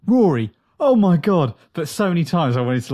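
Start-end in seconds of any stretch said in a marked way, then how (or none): 0.1-1.5 loud, high outburst at the TV; excited exclamation. Retold or imitated. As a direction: blurt it out at the screen. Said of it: "too late to be like".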